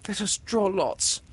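put-on high girl, brave and firm; bravely. Windy bravely offering to draw lots. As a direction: offer it with courage. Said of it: "Windy said bravely".

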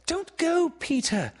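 high, urgent plea; entreating. A narrator's reading. Wendy begging Peter to stay, high entreaty. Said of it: "she entreated".

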